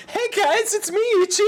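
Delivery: a deliberately bad anime girl intro; horrible Chie voice. Gamer introducing Chie in a hammed-up high girl voice. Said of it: "I'll just do horrible".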